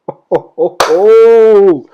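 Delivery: chuckling whoop into a long, loud "oh"; high, loud, gleeful hoot; excited whoop. Real, not acted. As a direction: let out a rising, drawn-out hoot. Excited about the completed weigh-ins.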